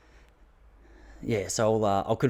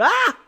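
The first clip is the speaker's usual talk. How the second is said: high, loud mock shriek of pain; imitated scream; 9 dB louder than usual. Wife hit in the eye by a branch, high loud mock shriek.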